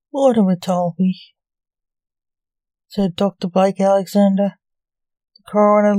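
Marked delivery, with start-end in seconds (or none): none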